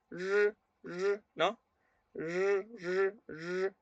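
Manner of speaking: long buzzing "r", mouth narrowed; "r" sustained without any trill; Mandarin R sound. Shown as a demonstration. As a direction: hold "r" as a drawn-out, high-held zh-like R. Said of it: "la lengua va más estrechada".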